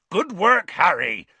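loud, high cheering shout; Hagrid's roar. A narrator's reading. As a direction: roar out the praise. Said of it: "roared Hagrid".

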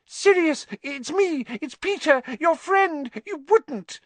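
high, loud, frantic squeal; grovelling plea. A narrator's reading. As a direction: beg for your life in a squeaky panic.

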